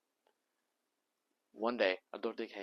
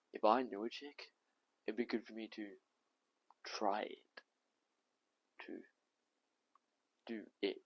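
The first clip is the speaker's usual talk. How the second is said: halting, hushed imitation of the doctor; near whisper; 4 dB louder than usual. Tom imitating the doctor leaning over the desk, practically whispering. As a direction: let it drop into a hesitant whisper with long gaps. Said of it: "practically whispering how".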